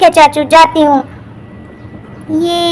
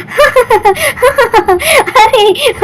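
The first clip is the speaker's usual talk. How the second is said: high laugh breaking out; can't hold back a laugh at the mess; burst of laughter; 4 semitones higher than usual. Acted. Mother finding husband soiled, bursting out laughing.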